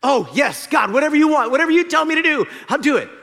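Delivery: eager pleading. Acted. Jack eagerly agrees.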